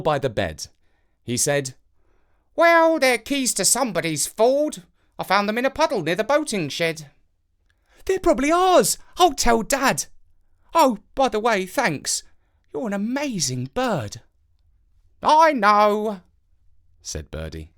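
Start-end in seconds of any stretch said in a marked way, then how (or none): 2.5-7.1 high, odd bird voice; a talking crow asking the boy about worms in a high odd voice. A narrator's reading. Birdie speaks. 8.0-14.2 put-on high boy's voice; a boy telling the crow about his dad, in a high child's voice; young boy. A narrator's reading. 15.2-16.2 high, loud self-satisfied bird; the crow accepting praise, high and smug. A narrator's reading. Birdie smug.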